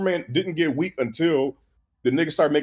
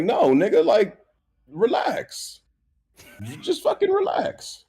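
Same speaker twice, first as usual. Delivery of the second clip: exasperated rant; 9 semitones higher, slower than usual. Real, not acted. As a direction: tell him to calm down, voice cracking upward. Ranting at Superman's recklessness.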